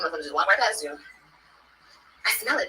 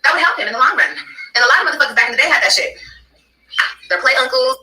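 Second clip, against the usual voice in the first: much louder, higher and defiant; heated defiance; 4 semitones higher, 10 dB louder than usual. Real, not acted. Arguing back at the other woman, loud and defiant. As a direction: shout her right to discipline her son. Heated argument on live stream.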